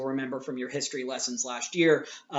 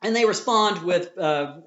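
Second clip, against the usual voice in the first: ordinary talk, nothing marked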